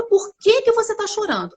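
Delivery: mildly pushy parent question; parent asking why. Retold or imitated. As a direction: lightly voice a parent demanding the reason. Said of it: "A gente pergunta".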